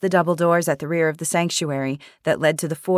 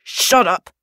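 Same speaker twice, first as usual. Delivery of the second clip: shout; 4 semitones higher, 6 dB louder than usual. A narrator's reading. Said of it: "the twitchy one shouted".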